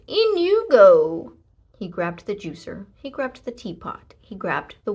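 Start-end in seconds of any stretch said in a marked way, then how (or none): 0.1-1.3 cheerful. A narrator's reading. Thief cheerfully loading bag.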